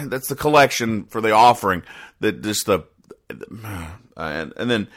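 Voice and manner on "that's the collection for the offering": ordinary talk, nothing marked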